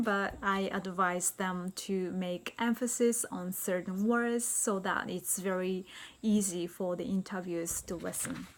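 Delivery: ordinary talk, nothing marked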